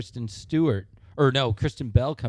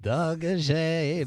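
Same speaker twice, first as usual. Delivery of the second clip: slow, drawn-out crooner line; 5 semitones higher, 4 dB quieter, much slower than usual. Retold or imitated. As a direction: stretch it like a Vegas crooner. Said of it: "that's [name]'s line".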